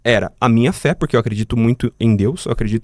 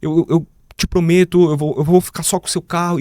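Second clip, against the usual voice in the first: a higher, tearful retelling of a robber's plea; retold crying plea; 5 semitones higher than usual. Robber breaks down crying while begging the victim. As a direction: imitate him pleading through tears, lightly. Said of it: "Começou a chorar copiosamente".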